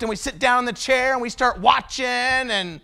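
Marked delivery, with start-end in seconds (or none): none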